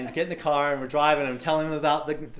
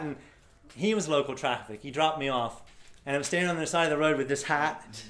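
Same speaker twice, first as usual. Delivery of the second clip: ordinary talk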